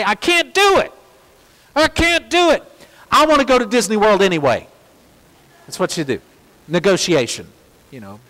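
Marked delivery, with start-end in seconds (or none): 0.0-4.7 put-on high, whiny child's plea, louder; frustrated little girl. Father voicing his frustrated daughter's whiny complaint. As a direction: play the daughter giving up in frustration. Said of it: "and she would say".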